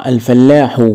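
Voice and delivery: normal talking voice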